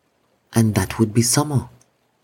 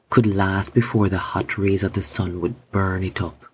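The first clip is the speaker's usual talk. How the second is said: ordinary delivery, nothing marked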